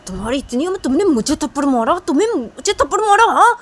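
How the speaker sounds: loud, very high outraged yell; outraged shout. Acted. Slapped bully yells at the new girl, high outraged shout. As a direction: scream that she dared slap you. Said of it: "उस लड़की को बोलती कि".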